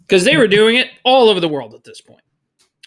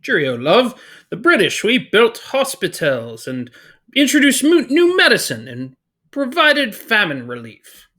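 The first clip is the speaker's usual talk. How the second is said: hammed-up British accent, rising higher; 4 semitones higher than usual. Teacher as the British Empire listing its gifts, mock British accent. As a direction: brag in a put-on British voice. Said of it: "let me get into British character".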